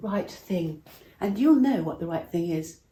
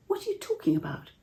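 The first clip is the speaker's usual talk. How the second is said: startled question; 13 semitones higher than usual. Acted. King startled by Esther's plea.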